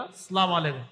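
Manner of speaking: "আসসালামু আলাইকুম" swallowed as "slamaleku"; slurred salam. Retold or imitated. Preacher mocking how people greet, "আসসালামু আলাইকুম" slurred into "slamaleku". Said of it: "আসেনি এরকম বলে না?".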